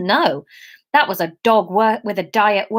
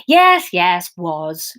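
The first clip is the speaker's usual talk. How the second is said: sighing; 2 semitones lower, 3 dB louder, much slower than usual. Acted. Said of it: "she sighed back".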